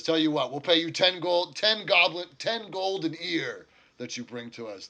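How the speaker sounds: high, loud orc making an offer; haggling orc. Acted. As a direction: raise the voice to close a bargain. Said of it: "he says".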